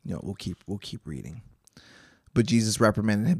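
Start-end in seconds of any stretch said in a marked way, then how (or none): 0.0-1.4 soft, low mumble to yourself; under-the-breath note before carrying on; quiet aside. Real, not acted. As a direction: drop the voice for an aside. Aside breaking off the reading.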